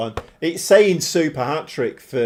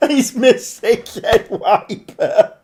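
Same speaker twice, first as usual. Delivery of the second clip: laughing; 6 semitones higher, 6 dB louder, slower than usual. Real, not acted. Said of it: "I don't know why I'm laughing".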